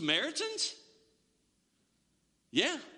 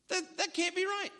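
higher, incredulous repeat; disbelief; 5 semitones higher than usual. Retold or imitated. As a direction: say it again as if the idea can't be true. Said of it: "what goes through your mind?".

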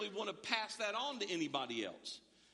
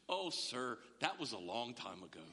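amused lady; 8 semitones lower than usual. Retold or imitated. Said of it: "And she goes".

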